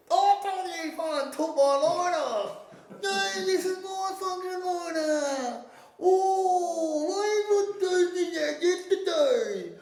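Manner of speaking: high, drawn-out mock slur; slurred homeless talk. Imitating homeless guys arguing over a lighter, high and slow. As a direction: stretch every word like a confused drunk.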